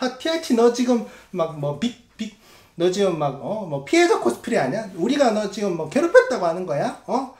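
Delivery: high, mocking heckler voice; mocking critic. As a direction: sneer it like an online critic.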